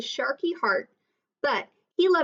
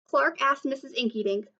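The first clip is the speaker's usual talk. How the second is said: plain reading voice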